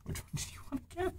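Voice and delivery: quick hushed aside; whispering. Real, not acted. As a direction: whisper it fast.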